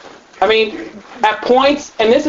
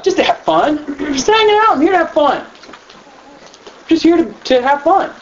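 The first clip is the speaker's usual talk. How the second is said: put-on carefree kid; 5 semitones higher than usual. Kids explaining why at club.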